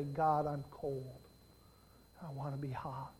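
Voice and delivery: low, soft pleading prayer; hushed confession to God; humble prayer. Acted. Preacher models a humble prayer.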